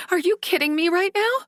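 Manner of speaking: high, fast, furious disbelief; a daughter's shrill outrage at being sold; incredulous anger. Acted. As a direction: hurl it at your father. Daughter confronts her father.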